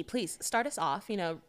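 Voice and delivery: put-on soft, affected pretty-girl persona. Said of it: "You're gonna do this voice the whole episode".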